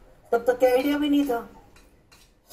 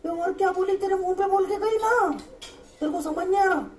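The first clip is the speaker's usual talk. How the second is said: exasperated friend; 7 semitones higher than usual. Retold or imitated.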